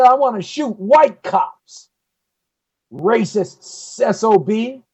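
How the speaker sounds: fed-up radio host ranting; aggravated. Real, not acted. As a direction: fire off indignant questions, piling them up. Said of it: "I'm aggravated".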